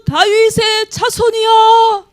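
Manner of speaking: high, slow, pleading cry to the Lord; high crying plea. Retold or imitated. Preacher acting the woman crying out to the Lord, high and slow. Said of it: "아까 사모님 얘기했죠".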